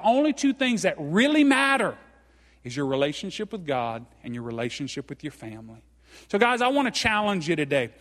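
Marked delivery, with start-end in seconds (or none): 2.6-5.8 much lower and softer, sincere; soft, earnest close. Real, not acted. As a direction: drop the voice to drive it home. Sermon climax dropping softly.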